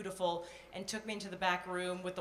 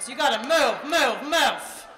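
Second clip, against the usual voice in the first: loud, higher rush-rush call; urgent hurry; 5 semitones higher, 12 dB louder than usual. Pretend. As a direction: push yourself to hurry. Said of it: "urging herself on".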